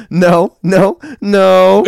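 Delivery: hammed-up childish wail breaking into laughter; little boy. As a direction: imitate an upset little boy from a meme. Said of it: "goes to that little boy".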